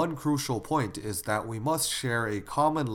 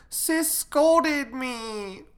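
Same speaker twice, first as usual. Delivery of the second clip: put-on high, loud, drawn-out child's wail; crying little girl; 14 semitones higher, 5 dB louder, much slower than usual. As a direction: wail a tattletale complaint to mother, very slowly. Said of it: "While crying, she walked to the kitchen".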